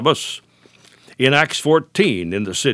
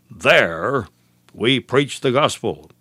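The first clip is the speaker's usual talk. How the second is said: "there" set apart by a pause; stress on THERE; slower than usual. Shown as a demonstration. Preacher applying the text to his broadcast, stress on "there". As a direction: land firmly on "there", pause, then continue. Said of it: "So why the stress at this point?".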